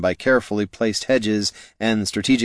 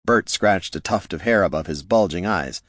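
usual speaking voice

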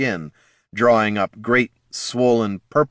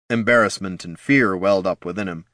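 ordinary delivery, nothing marked

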